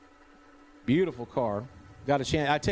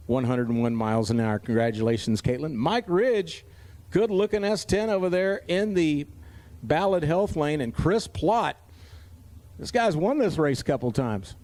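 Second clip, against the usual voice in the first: ordinary delivery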